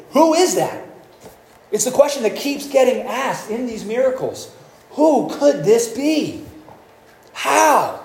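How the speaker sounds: high, wondering voice asking who this is; awestruck question. Retold or imitated. Disciples in the storm-tossed boat, asking in high awe. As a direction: ask it like a stunned disciple.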